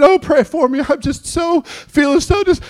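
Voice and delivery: high, whiny plea; mock whimper. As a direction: whimper like a worn-out churchgoer. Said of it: "calling your pastor saying".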